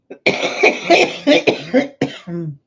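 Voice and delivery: loud choking cough. Real, not acted. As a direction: choke and cough. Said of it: "that choked me up".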